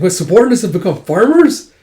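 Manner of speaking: high, loud incredulous question; shocked disbelief. Retold or imitated. Nobleman hearing his men farmed, shocked and loud. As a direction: blurt it out in disbelief.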